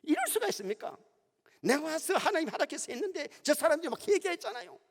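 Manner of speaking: high, indignant retold protest to God; Jonah's mock anger. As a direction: act out Jonah's huffy complaint, pitched high. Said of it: "요나가 막 화를 내면서 썽을 냅니다". (요나를 흉내 낸 높은 목소리의 화난 항의)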